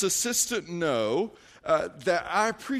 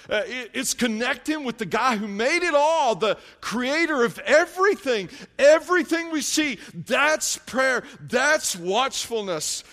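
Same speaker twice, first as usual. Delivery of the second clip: louder, higher, rising fervor; preaching with fire; 5 semitones higher, 4 dB louder than usual. Real, not acted. Pastor urging church to pray, louder and fervent. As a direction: proclaim it to the whole room.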